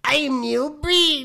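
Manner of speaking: very high, slow, loud ghoul voice; Crypt Keeper voice. Pretend. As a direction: stretch it out like a cackling horror host.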